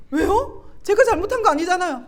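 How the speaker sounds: high, loud acted objection; indignant protest. Wife arguing with God over apologizing, indignant protest.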